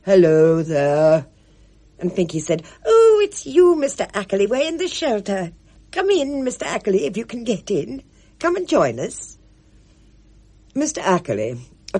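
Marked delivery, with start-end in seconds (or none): 0.0-1.3 slow, louder call from outside; answering call. A narrator's reading. Mister Ackley answers from the garden, slow call. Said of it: "An answering voice called". 2.8-9.4 put-on higher, fluttery old lady; eager Pinky. A narrator's reading. Pinky fusses so Spud need not move, fluttery.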